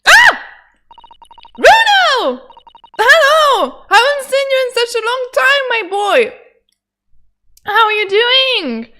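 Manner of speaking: put-on high, loud hearty greeting to an old friend; cheery character voice. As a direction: boom out a joyful hello, pitched well up. Voicing a character greeting Runo.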